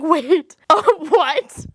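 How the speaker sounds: startled high laugh of disbelief; surprised laugh. Retold or imitated. Reacting to ex dissing her cat, laughing surprise. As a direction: laugh out, caught off guard.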